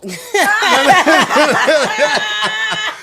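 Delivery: long loud burst of high laughter; hearty high-pitched laugh. Real, not acted. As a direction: let out a big high laugh.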